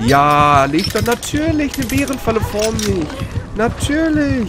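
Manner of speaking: high, whining sarcasm; sarcastic complaint. Real, not acted. Player seeing a trap laid before them, whining sarcasm. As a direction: whine it in exasperated mock approval.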